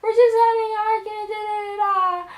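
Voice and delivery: high, loud whiny retelling; exaggerated high-pitched protesting; mock frantic reassurance. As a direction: insist in a very high, hammed-up voice. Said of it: "And I'm like".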